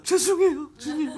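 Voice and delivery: high, tearful voice near breaking; mock choked-up sorry to the Lord; tearful apology. Pastor imagining tearfully apologizing to the Lord. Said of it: "그러다 울먹이다가".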